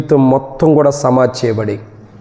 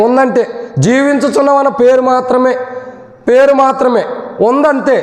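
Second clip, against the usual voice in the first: preaching at full force; 11 semitones higher than usual. Acted. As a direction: preach it out to the whole hall. (పూర్తి శక్తితో బోధించే స్వరం)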